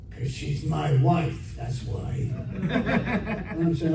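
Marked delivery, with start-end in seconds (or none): 0.1-2.3 mock menace. Acted confrontation by jealous-seeming husband.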